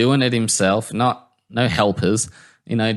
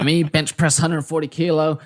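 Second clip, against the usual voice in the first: higher, animated impersonation; put-on, pitched-up Southern pep talk; Booty; 6 semitones higher, faster than usual. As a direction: imitate an eager gym guy piling on instructions. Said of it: "he's, he's like".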